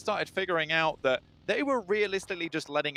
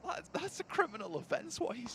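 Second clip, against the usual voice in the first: soft, stunned mock accusation; quietly appalled, in jest; hushed disbelief; 11 semitones higher, 9 dB quieter than usual. Real, not acted. Caster joins the stunned mock outrage.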